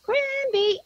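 high, sing-out two-note holler; yelled call. Retold or imitated. Grandmother showing child what to yell, high drawn-out call. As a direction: stretch the name out as a high call. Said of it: "So I yelled out".

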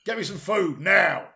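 loud, barking rude customer; bossy diner summoning staff; demanding diner. Acted. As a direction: order the waiter about, punchy word by word. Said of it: "and he went".

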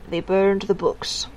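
slow, level and drained of feeling; a stunned, deadpan repeat; flat voice. A narrator's reading. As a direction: say it slowly, cold and toneless. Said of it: "said Miss Tick in a flat voice".

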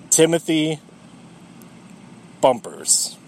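Timothy plain, Bumpers very low; deep drop on Bumpers. Shown as a demonstration. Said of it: "a million times better".

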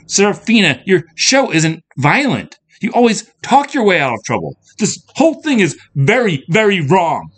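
high, loud, upset pleading; distressed outburst; tearful protest. A narrator's reading. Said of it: "Andrea felt the tears".

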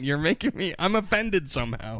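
normal talking voice

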